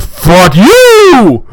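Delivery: a hammed-up bride screaming at her bridesmaids; retold yell. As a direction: fling it like a bratty curse. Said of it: "So I said".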